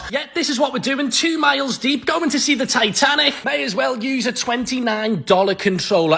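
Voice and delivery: ordinary delivery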